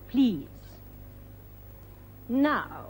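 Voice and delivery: ordinary delivery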